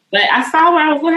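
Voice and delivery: pushing through as the tears come; getting emotional. Real, not acted. Telling listeners about her breakthrough, getting emotional. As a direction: voice rising high and fast, welling up. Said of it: "I'm about to get emotional".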